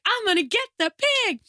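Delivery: a hint of Malkovich fussing over his pig; imitating John Malkovich. Retelling the Red pig scene, light Malkovich impression. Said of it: "He's like".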